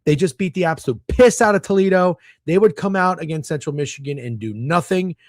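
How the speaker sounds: ordinary delivery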